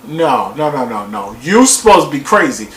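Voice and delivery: low, fading repeated no; dismayed protest. Retold or imitated. Him protesting at the TV, low and dismayed. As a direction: shake it off in disbelief. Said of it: "I was like".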